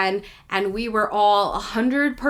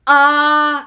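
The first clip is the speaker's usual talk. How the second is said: stunned uh; 6 semitones higher, 9 dB louder than usual. Retold or imitated. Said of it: "And I'm like".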